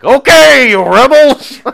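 high, loud exasperated shout; hammed-up outburst at a grumbling crowd; Moses. Moses snapping at the thirsty rebels, exasperated. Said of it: "Moses. It's like".

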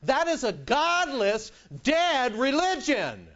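slow, high, loud condemnation; thundering preacher verdict; forceful denunciation. Real, not acted. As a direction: hammer it home. Preacher denouncing at full force.

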